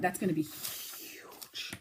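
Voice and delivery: slow hushed whisper of delight; excited whisper. Real, not acted. Knitter whispering to viewers about her toy, hushed and slow. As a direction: breathe it out slowly like a secret.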